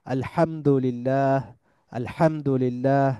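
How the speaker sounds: drawn-out আলহামদুলিল্লাহ with a constricted h; slow Arabic আলহামদুলিল্লাহ, h squeezed mid-throat; heavy হা example. Shown as a demonstration. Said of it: "যেমন আমরা বলি".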